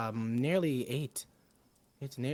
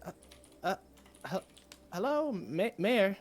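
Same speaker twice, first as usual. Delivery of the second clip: nervous bard voice; 6 semitones higher, slower than usual. Acted. Bard character addresses the mayor.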